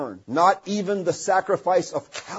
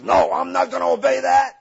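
higher, defiant mock refusal; stubborn refusal; 7 semitones higher, 3 dB louder than usual. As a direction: play the stiff-necked sinner saying no. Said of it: "stiffens up ... and says".

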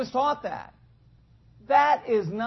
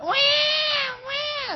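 imitated baby cry. Preacher imitating a baby's huge cry, high wail. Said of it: "They have this huge cry".

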